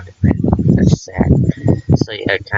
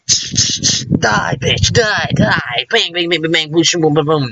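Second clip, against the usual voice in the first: higher, hammed-up cartoon killing spree with bang and boom sound effects; mock violent rampage; 7 semitones higher, slower than usual. Streamer joking what dark does not mean, hammed-up gleeful shooting spree. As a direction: goof around like a gleeful action-movie villain. Jokes about a different dark.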